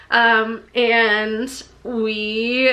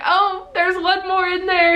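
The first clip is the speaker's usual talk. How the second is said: nervous hint; 8 semitones higher than usual. Retold or imitated. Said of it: "So I was like".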